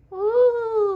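high loud whooping ouh; imitated wind howl. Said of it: "Et le pingouin l'imita".

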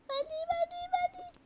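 high, eager pleading to be picked; high excited child voice; eager kid calling out. Acted. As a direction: squeal it out like a kid begging not to be chosen. Kids shouting to be picked.

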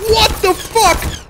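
high, drawn-out shocked cry; shocked outburst. Real, not acted. Startled by sudden slowdown.

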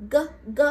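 G as a short hard g; g sound. Shown as a demonstration. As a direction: pop out G, G loudly. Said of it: "G makes the sound G".

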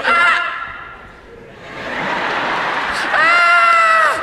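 hammed-up yell for the photo; posed camera scream. Rider spotting the camera on the coaster, posed screaming.

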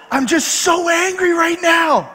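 high, drawn-out mock yell; mock furious. Wife venting to husband on the phone, mock furious. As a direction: wail it out like an exaggeratedly livid wife.

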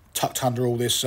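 usual speaking voice

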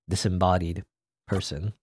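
ordinary talk, nothing marked

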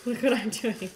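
usual speaking voice

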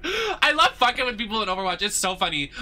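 high-pitched, squealing with amusement; gush, still tickled by your own prank; giddy troll's confession; gleeful delight. Real, not acted. Delighted after laughing at trolling.

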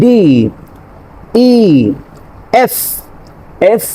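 each letter said slowly, set apart; letter demo. Shown as a demonstration. Teacher models letters for students. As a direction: spell out ডি ই এফ one by one. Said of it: "লেটার্সগুলো আমি কীভাবে pronunciation করি".

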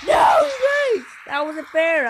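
very high, loud yell of protest; real shout of protest that tapers off; outraged shout. Real, not acted. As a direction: scream it at the game. Gets popped by bomb.

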